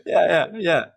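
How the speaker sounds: high, loud laughing yeahs; real laughter. Real, not acted. Speaker laughing with host over the pub story, real laughter. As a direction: laugh it out, still amused.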